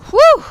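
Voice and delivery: high, loud whew of relief; relieved whew. Acted. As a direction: let out a big exclaimed whew. Escape into the tunnel.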